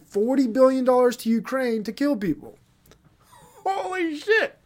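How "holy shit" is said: shocked exclamation. Real, not acted. Shocked outburst at own point.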